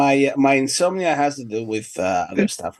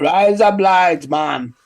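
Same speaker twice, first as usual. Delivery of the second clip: slow, higher "Rise up lights" with mock Jamaican lilt; mock island accent; 3 semitones higher, much slower than usual. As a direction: draw it out in a playful Jamaican accent. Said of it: "she's not Jamaican".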